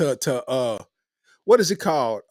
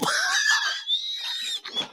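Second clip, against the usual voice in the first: very high shriek of laughter; 7 dB quieter than usual. Real, not acted. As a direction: scream with laughter. Big laugh after punchline.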